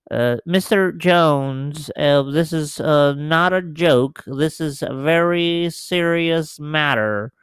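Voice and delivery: a mild stab at a prim, nerdy officer. Acted. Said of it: "speak in your high-pitched, nerdy voice".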